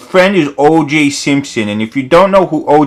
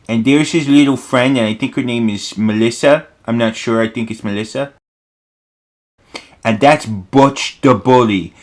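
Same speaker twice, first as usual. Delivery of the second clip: ordinary delivery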